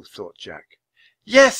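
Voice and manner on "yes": put-on very high, loud girl's yes; high-pitched, determined outburst; eager assent. A narrator's reading. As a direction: agree with bold excitement. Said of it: "said Annie".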